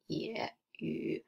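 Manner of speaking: "ye, yu" each dipping in a curve; third tone. Shown as a demonstration. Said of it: "Third tone".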